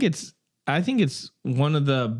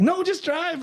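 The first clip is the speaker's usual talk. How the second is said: high, hurried panicky order; panicked crook voice; slower than usual. Retold or imitated. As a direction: bark it like a fleeing crook. Quoting the Penguin fleeing.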